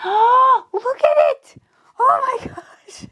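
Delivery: high, breathless squeal of delight; gasping excitement. Real, not acted. Crafter gasping at her finished clock, excited squeal. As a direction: gasp and gush over the finished clock. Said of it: "I'm excited".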